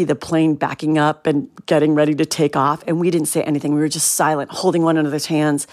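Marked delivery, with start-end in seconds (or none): none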